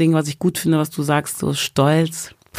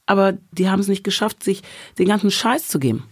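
usual speaking voice

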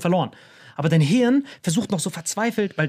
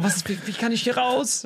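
high, stammering plea; mock tearful plea; 6 semitones higher than usual. Caught boy begging to leave, mock tearful plea. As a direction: plead high, as if near tears. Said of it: "Ich hab so geheult".

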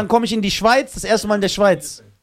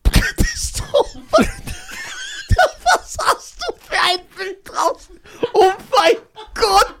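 high squealing laughter through the words; laughing fit; slower than usual. Real, not acted. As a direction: shriek with helpless laughter at the picture. Lachanfall über ein Bild. (kreischend vor Lachen)

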